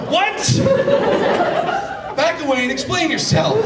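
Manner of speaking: loud, high, laughing disbelief; real laughing outrage; shocked outburst. Real, not acted. Friend's weird confession.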